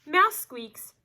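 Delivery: lively storybook voice, big swings of pitch and loudness; animated read-aloud; reading to children. A narrator's reading. Teacher reads picture book aloud.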